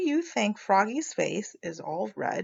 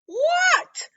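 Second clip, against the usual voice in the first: much higher startled what. Acted. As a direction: answer from the water, surprised. Frog answering from water.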